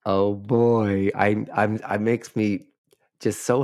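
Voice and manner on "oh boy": ordinary talk, nothing marked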